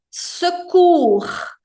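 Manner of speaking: Secours mais alto, U bem marcado; pronúncia francesa marcada. Shown as a demonstration. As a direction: destaque o U de Secours. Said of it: "o som do U". (Secours com U francês)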